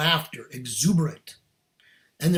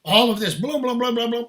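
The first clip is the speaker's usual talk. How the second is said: higher, fast, frantic blurting; imitated tornado rant; 6 semitones higher, 6 dB louder than usual. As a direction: act out a friend's rushing flood of news. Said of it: "it was like a tornado".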